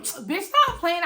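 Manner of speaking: a hammed-up dismissive roommate; mock roommate. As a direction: mimic the roommate brushing it off, drawn out. Voicing laughing roommate.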